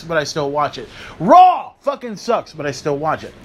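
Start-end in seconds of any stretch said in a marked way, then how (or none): 1.2-2.5 mocking rant. Real, not acted. Ranting list of promotions.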